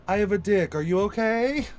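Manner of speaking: mock lady boy voice. Podcaster retelling a YouTube clip, the lady boy asking in a loud high mock voice. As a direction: push it up high and loud, teasing.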